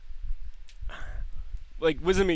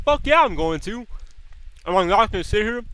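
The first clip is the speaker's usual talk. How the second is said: raised, louder retold comeback; defiant retort; 5 semitones higher, 4 dB louder than usual. Son going back and forth with mom, defiant retort. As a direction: argue back, pitched up and punchy.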